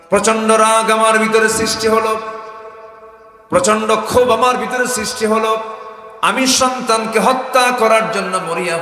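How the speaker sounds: loud, high chanted outburst; full-force preaching. Acted. Father telling of his murderous rage, full-force preaching chant. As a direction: drive each phrase out high and loud.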